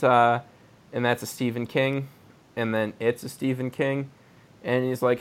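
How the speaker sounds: ordinary delivery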